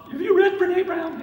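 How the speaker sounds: high mock-daughter voice; imitated daughter, held high; imitating daughters. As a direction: pitch it way up as an eager daughter asking. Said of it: "my daughters all read her".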